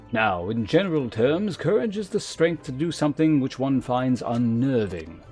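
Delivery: formal, measured mentor with deliberate emphasis; lancer guildmaster. Acted. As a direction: instruct a pupil with grave authority. Voices the lancer guildmaster's dialogue again.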